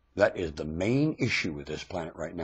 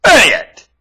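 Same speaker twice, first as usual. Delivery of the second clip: angry insult; 13 semitones higher, 17 dB louder than usual. Real, not acted. Ranter insults the audience, angry bark. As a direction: bark it high and loud.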